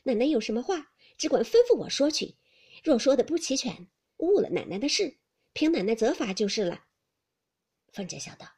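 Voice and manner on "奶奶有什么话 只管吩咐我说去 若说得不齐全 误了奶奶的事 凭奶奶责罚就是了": louder, confident and keen; eager smiling maid. A narrator's reading. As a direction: promise it with a smile. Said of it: "红玉笑道".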